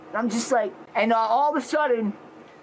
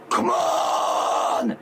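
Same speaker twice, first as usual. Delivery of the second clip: retold battle shout. Retold fight, challenging the gang.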